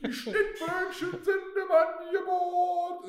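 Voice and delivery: high, slow singsong sales call; calling out offers. Retold or imitated. Bofrost man calling his offers up the stairwell, high and slow. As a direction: sing out the offer slowly up high. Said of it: "rief immer seine Angebote da durch".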